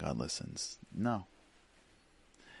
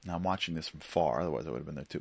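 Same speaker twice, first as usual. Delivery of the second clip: usual speaking voice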